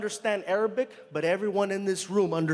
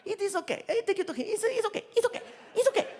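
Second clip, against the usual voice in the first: much higher, laughing dismissive driver voice; driver reassuring; 12 semitones higher, faster than usual. Retold or imitated. A driver dismisses the comedian's question, high and laughing. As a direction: wave off the worry with a chuckle.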